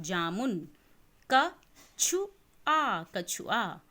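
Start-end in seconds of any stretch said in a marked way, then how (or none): 1.3-3.0 पुल drawn out slowly and loud, each sound clear; slow syllabic reading. Shown as a demonstration. Teacher reads पुल slowly, loud and stretched. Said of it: "देखिए कि मैं किस तरीके से पढ़ रही हूँ".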